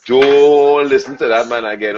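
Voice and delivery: put-on loud, long-held opening word, Trinidadian accent; Trini player gossiping. Elite players to each other behind the newcomer's back, scornful Trinidadian outburst. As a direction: exclaim it in exaggerated Trini dismay. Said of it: "go back to each other".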